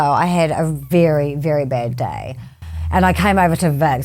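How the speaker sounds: normal talking voice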